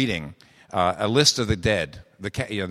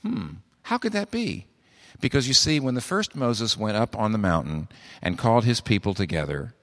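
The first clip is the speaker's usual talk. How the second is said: ordinary delivery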